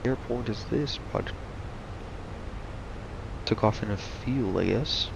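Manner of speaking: usual speaking voice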